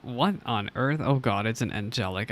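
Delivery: ordinary talk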